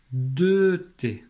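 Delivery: « deux » appuyé plus haut et plus fort; insistance sur deux. Shown as a demonstration. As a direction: marquer « deux » face à « un T ». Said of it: "pas un T, mais". (accent d'insistance sur « deux » dans « deux T »)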